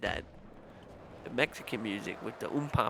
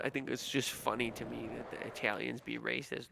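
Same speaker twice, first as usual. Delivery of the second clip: ordinary talk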